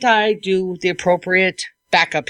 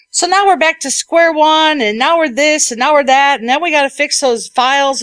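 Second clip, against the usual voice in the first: mock exasperated client; 7 semitones higher, 4 dB louder than usual. Imitating mad client's complaint.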